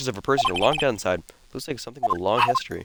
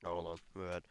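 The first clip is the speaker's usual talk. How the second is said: ordinary talk, nothing marked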